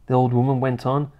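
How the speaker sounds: usual speaking voice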